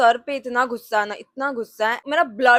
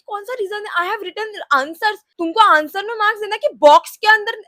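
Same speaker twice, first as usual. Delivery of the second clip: indignant outburst; 8 semitones higher, 6 dB louder than usual. Real, not acted. Indignant at unfair marking.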